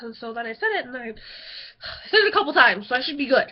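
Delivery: ordinary talk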